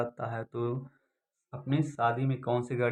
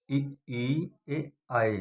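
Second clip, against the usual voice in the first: ordinary delivery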